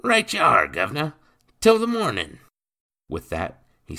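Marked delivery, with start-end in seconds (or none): 0.0-2.4 high, loud mock-innocent cockney. Rogue to Mister Bennet, mock-innocent cockney. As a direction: play the harmless rogue, all sweetness. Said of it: "Nathan smiled innocently".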